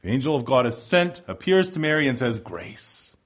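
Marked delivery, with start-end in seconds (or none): 2.4-3.1 hushed. Acted. Angel greets Mary, hushed.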